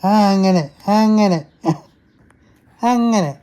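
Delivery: high, loud approving call. Real, not acted. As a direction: cheer the little one along. Father cheering baby along. (കുഞ്ഞിനെ ഉറക്കെ പ്രോത്സാഹിപ്പിക്കുന്നു)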